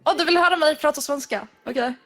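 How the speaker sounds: quick, bright Swedish greeting; speaking Swedish. Shown as a demonstration. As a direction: show off your Swedish, fast. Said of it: "listen to you speaking Swedish".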